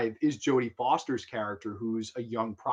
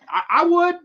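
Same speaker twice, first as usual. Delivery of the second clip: much higher and louder; emphatic repeat; 10 dB louder than usual. Real, not acted. Fan insisting he'd take her, high and loud. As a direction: push it up with full conviction. Repeats his answer, building up.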